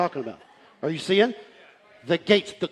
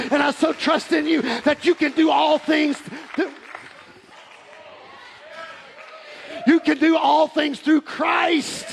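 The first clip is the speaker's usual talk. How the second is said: high-pitched, driving pulpit delivery; full-force preaching; 8 semitones higher, 5 dB louder than usual. Real, not acted. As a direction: preach it hard, punching key words.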